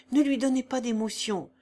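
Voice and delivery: usual speaking voice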